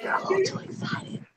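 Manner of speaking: very soft whisper; teasing whisper. Pretend. To the robot pet being rubbed, teasing whisper.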